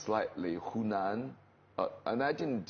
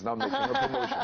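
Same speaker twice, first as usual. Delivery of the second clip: high mock voice; 6 dB louder, faster than usual. Mimicking high voice aside.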